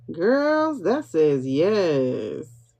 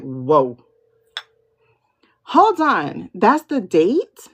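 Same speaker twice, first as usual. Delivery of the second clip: excited; 4 dB louder, slower than usual. Retold or imitated. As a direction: hit by sudden thrill. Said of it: "the excitement that just, like, flooded me".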